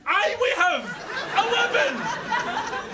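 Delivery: high, exasperated yell; indignant, drawn-out outburst; angry retort. Acted. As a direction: shout it back at the machine, dragging out the last word. Shouting back at the lift.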